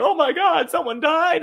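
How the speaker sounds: high-pitched, loud fake shock; imitating a scandalized gasp; mock aghast. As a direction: act horrified, hammed right up. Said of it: "you're not allowed to be aghast".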